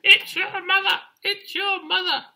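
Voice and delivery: very high, loud falsetto squawk; squawking birds. Acted. Birds announce the chick's mother, loud high squawk. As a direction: screech the news like excited birds. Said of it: "squawked the birds in the trees".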